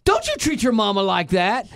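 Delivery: put-on loud, high scolding shout at a boy; scolding yell. Stranger scolds a misbehaving boy in line, loud scolding shout. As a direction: imitate a stern old lady yelling. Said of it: "smacks this kid and goes".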